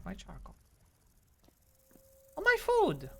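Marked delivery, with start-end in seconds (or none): 2.4-3.1 very high squeal; excited exclamation. Real, not acted. Player spotting their food in the game, squealing high. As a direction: squeal it at a sudden find.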